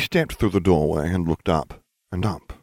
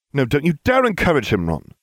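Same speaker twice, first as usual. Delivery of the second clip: scolding; 8 semitones higher, 3 dB louder than usual. A narrator's reading. Hermione scolding.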